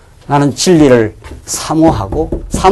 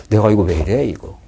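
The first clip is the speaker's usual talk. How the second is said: inner voice; 8 semitones lower, 7 dB quieter than usual. Retold or imitated. Preacher voices his own troubled conscience.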